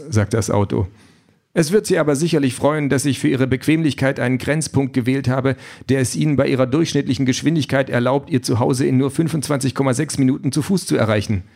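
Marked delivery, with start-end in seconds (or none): 1.5-11.4 higher, smoothly courteous machine voice; the car apologetically throws him out, all politeness; overly courteous AI car, regretful; polite car voice. Acted.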